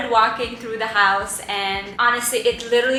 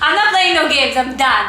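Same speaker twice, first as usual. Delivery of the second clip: loud, higher, no-nonsense declaration; determined; 4 semitones higher, 8 dB louder than usual. Retold or imitated. Wife telling husband she's set on the house, loud and firm. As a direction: put your foot down with total resolve.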